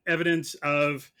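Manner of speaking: usual speaking voice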